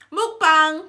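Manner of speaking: loud excited announcement; shout. Real, not acted. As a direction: shout it out gleefully.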